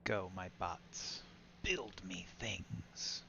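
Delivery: slow, drawn-out order to the bots; slow mock command. Player to bots, "build me things", slow mock command. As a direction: command the little workers, stretching each word.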